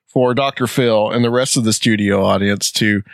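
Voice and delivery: ordinary talk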